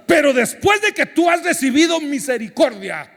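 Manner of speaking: high, loud preaching burst; preaching shout. Real, not acted. Pastor warning the congregation, shouted high and loud. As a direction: hammer the point home.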